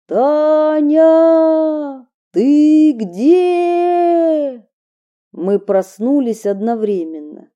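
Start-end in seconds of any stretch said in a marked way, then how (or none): 0.1-4.7 high, loud, drawn-out call; shouting. Acted. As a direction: call out for her from afar. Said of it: "кричит".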